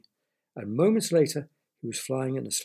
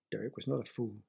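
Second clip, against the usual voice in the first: ordinary delivery, nothing marked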